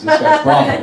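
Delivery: high-pitched mock bafflement; baffled other dad. Another dad reacting to trash talk, high and baffled. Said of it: "And he's like".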